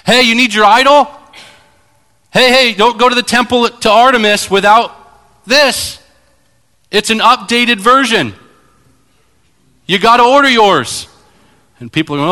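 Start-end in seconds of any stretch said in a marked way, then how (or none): 0.0-11.1 high, loud pushy salesman pitch; eager idol peddler calling to passersby; street hawker. Retold or imitated. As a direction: hawk it like a market vendor. Idol seller hawking.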